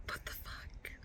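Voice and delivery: tearful breathy whisper; stunned whisper. Real, not acted. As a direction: whisper in disbelief. Crying over book.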